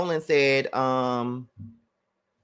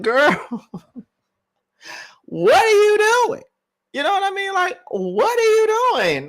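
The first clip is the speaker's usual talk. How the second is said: incredulous; 4 dB louder than usual. Real, not acted. Baffled by her on stream.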